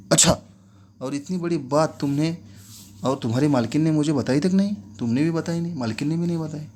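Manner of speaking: a master stunned that nobody told him; shocked. Acted.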